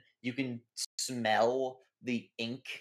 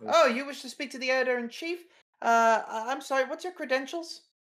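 high, loud, chirpy receptionist voice; front desk clerk; 12 semitones higher, 3 dB louder than usual. Acted. Receptionist greeting visitors at the newspaper front desk, high bright voice. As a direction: greet them like an eager receptionist.

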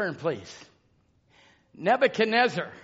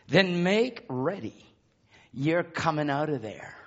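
hushed preaching; 3 semitones lower, slower than usual. Acted. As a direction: draw it out low, phrase by phrase, with long pauses.

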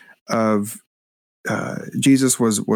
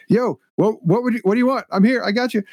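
casual shout across; 12 semitones higher than usual. Retold or imitated. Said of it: "could have just shouted across the road".

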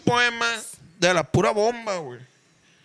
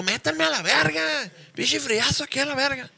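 lightly voiced complaining buddy; a hint of a grumbling drunk friend; imitating a friend; 5 semitones higher than usual. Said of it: "ya la está haciendo de pedo".

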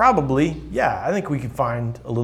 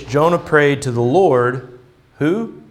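usual speaking voice

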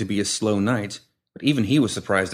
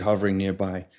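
plain reading voice

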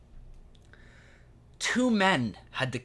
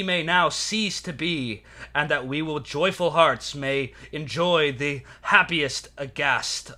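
roman orator. Acted. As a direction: declaim it to the Senate like a Roman orator. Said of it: "And I quote".

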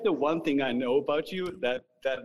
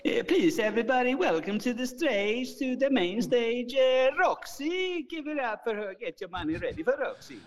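Swedish accent; 9 semitones higher than usual. Shown as a demonstration. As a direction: stretch each word like a Swedish club MC. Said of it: "my introduction in a Swedish accent".

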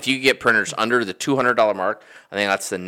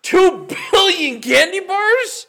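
high, slow laughing disbelief; 3 dB louder, much slower than usual. Real, not acted. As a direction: laugh it out, stunned by the number. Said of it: "Oh, man".

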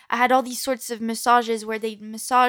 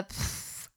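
mock French puff of air; 19 dB quieter than usual. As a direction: blow a French-style pff. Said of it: "they always make noises".

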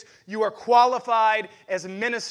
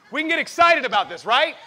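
much higher and louder, rallying; excited shout; 6 semitones higher, 9 dB louder than usual. Real, not acted. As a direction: whip the crowd up with a shout. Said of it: "two people can get excited about this".

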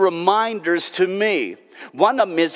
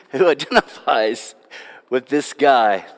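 usual speaking voice

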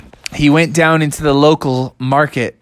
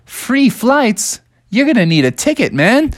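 higher amused clerk voice; airport clerk; 8 semitones higher than usual. Acted. Airport desk clerk tells Bubby tickets cost money, high and teasing. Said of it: "And they said".

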